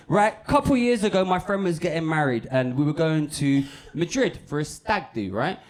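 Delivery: ordinary talk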